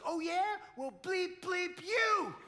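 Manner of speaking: high, loud mock curse back; mock angry yell. Husband imitating wife cursing back at him. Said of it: "and she goes".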